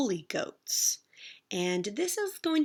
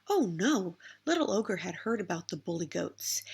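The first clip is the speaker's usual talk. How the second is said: ordinary delivery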